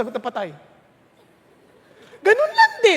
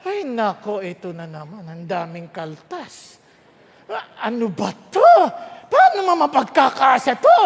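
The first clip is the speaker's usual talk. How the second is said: payday complaint; 2 semitones lower, 4 dB louder than usual. Retold or imitated. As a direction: grumble at the deductions, then blow up over the pay. Mocking a worker complaining about pay deductions.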